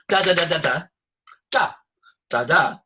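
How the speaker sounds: varied bursts of spoken "da" syllables; playful syllable patterns with gaps; rhythm demo. Shown as a demonstration. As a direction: tap out changing rhythms with the voice. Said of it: "What's rhythm?".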